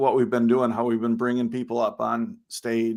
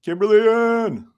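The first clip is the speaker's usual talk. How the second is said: excited greeting; 9 semitones higher, 6 dB louder than usual. Real, not acted. Calling out a greeting.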